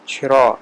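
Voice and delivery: چھرا with breathy chh; aspirated chh. Shown as a demonstration. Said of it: "لفظ چرا، وہ چھرا".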